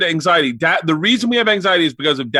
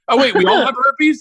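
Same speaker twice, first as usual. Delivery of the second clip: surprised; 7 semitones higher than usual. Retold or imitated. Retold family exchange.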